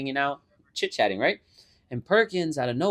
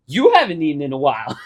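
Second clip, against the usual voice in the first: smug; 3 semitones higher, 8 dB louder than usual. Retold or imitated. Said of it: "This motherfucker goes".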